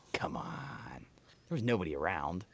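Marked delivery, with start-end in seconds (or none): 0.1-1.0 whispered come on, breathy and low. Shown as a demonstration. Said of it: "But c-, come on".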